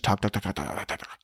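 talk, talk fading to nothing; fading repetition. Acted.

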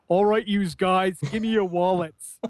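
put-on a hammy Brooklyn thug accent; American accent. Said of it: "his American accent".

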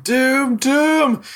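high, frantic cries of doom; gnome crying doom. Acted. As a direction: wail doom like a mad little prophet. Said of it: "running around the schooner saying".

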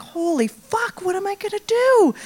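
high, rising panicked cry of dismay; sudden panic at the wrecked car; panicked thought. Retold or imitated. Panicked inner thought at damage.

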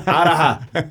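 a burst of laughter, high and loud; real laugh breaking through. Real, not acted. Laughing after "never heard of it.

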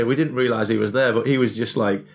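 normal talking voice

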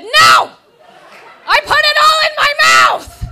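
very loud, high yelling while laughing; frantic shout. Retold or imitated. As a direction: yell to stop her grabbing the chocolate. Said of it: "and I said".

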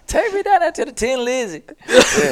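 laughing. Real, not acted. Laughing while joking about the accent.

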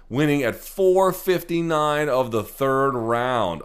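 loud, high, drawn-out amazement; amazed emphasis. Real, not acted. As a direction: stretch it out, high and loud, in disbelief. Amazed recounting of last-second win.

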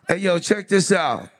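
ordinary delivery

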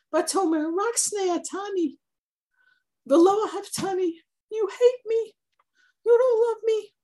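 high, pleading wife's complaint; a hurt wife whining at her husband; whiny wife. Retold or imitated. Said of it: "the wife of Shimshon cried to him".

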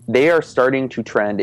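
ordinary talk, nothing marked

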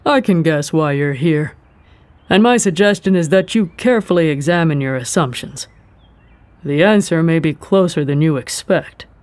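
measured, knowing tone; hint at a secret calmly; cryptic official. A narrator's reading. Ren giving cryptic advice.

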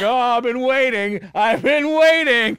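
loud, high sarcastic gushing; mock eagerness. Mocks himself as eager proposer.

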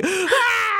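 teary squeal. Retold or imitated. Woman seeing a rescued cat healed, high teary squeal. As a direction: squeal like a photo just broke you. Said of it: "and you're like".

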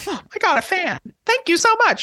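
high, gushing thanks; flattered gush. Retold or imitated. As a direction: squeal with delight at the compliment. Thrilled reply to a compliment.

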